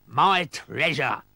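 slow, drawn-out pirate yell. A narrator's reading. Captain spots his treasure chest, drawn-out yell. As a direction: yell it long in character.

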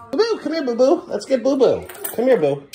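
high, sing-song call to the cat; high cooing cat call. Real, not acted. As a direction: coax the cat over in a high voice. Calling the cat.

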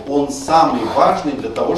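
ordinary talk

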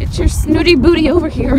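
loud playful call across a field; loud teasing call to a horse. Real, not acted. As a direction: shout him over teasingly.

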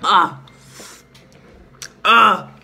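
loud sour yelp; pained sour gasp. Real, not acted. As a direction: cry out at the sourness. Sour candy hits.

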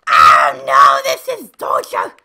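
very loud, drawn-out wail of frustration; anguished shout. Real, not acted. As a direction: holler it slowly in exaggerated torment. Frustrated after dying again.

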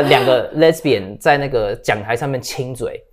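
usual speaking voice

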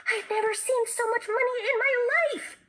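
high, loud squeal of amazement; thrilled outburst. A narrator's reading. Jessie hopping about over the money, thrilled squeal. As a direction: cry out high and overjoyed.

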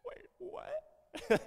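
comic confused double take; baffled. Pretend. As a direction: caught totally off guard. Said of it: "Be like".

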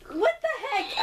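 high surprised outburst. Real, not acted. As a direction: caught off guard. Game surprise.

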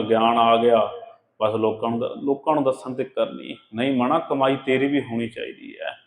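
normal talking voice

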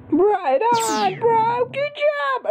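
high mocking echo of the game voice; mock cheerleader. Mocking the game announcer's praise, high cheerful voice.

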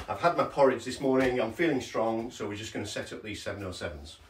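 ordinary delivery

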